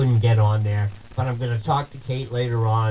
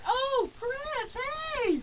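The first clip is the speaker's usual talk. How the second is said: much higher, delighted cry of recognition; excited greeting; 9 dB quieter, slower than usual. Retold or imitated. Kate greeting a radio host at her door, delighted and much higher. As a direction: squeal his name like an old friend. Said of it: "She's like".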